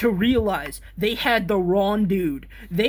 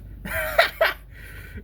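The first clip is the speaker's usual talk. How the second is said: high breathy villain cackle; evil laugh. Shown as a demonstration. Still hamming up the evil laugh. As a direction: let out a wicked cackle. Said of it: "the fucking evil laugh".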